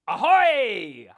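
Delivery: loud, high, drawn-out pirate hail. Pretend. Pirate teaching children the word ahoy, hearty hail. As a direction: call out ahoy as a pirate greeting.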